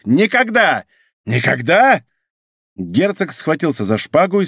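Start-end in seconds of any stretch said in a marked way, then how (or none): 0.0-0.8 high exclaimed refusal; defiant higher-pitched cry; emphatic refusal. A narrator's reading. Duke refuses to sign. 1.3-2.0 restrained, challenging repeat; challenging echo. A narrator's reading. Grim echo of the refusal.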